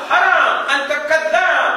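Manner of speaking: loud, high, slow Arabic rebuke; mock Arabic scolding. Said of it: "Kita kata".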